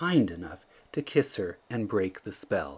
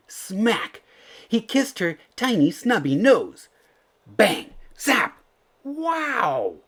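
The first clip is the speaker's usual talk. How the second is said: excited exclamations; 10 semitones higher, 5 dB louder, slower than usual. A narrator's reading. Sound words of the magic kiss.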